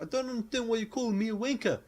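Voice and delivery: high, whining complaint; mock whiny man. Ewan McGregor to Blondie, high whining complaint. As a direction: whine it in a put-upon voice. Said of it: "Ewan McGregor's constantly like".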